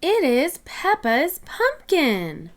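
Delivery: usual speaking voice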